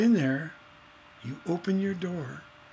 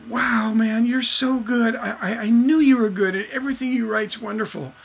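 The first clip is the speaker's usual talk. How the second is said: higher, louder gushing praise; admiring biggest fan; 6 semitones higher, 5 dB louder than usual. Acted. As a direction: heap praise like a keen fan. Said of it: "your biggest fan".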